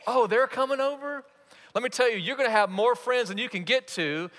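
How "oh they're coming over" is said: high-pitched happy surprise; light up at the news of guests; acted delighted question, pitch jumping up; excited. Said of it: "are they excited?".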